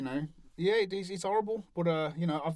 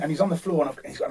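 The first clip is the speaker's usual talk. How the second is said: usual speaking voice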